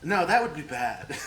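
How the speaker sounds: giggling through the line; laughing. Real, not acted. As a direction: laugh while you say it. NPC line broken by laughter.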